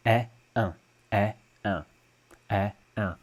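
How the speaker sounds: eh then un with the vowel nasalized; eh versus nasal un. Shown as a demonstration. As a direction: contrast oral eh with nasal un. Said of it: "nasalized version of eh".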